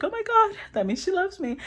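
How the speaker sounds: high, gushing imitation of excitement; mock excited. Partner thrilled that she loves them, mock excited. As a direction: squeal it like a thrilled admirer. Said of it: "this person gets so excited".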